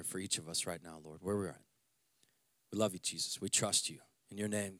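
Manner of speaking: soft, low, prayerful voice leading the church; hushed prayer. Acted. Pastor praying softly over his church before the sermon. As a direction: pray quietly over the congregation.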